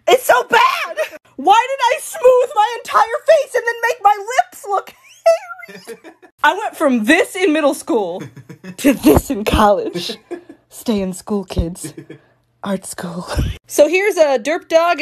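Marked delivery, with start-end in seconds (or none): 0.1-6.0 high-pitched laughing wail; real cringing laughter, pitch climbing; laughing embarrassment. Real, not acted. Mortified by own old portrait. 10.7-13.3 deadpan. Pretend. Deadpan joke advice to kids.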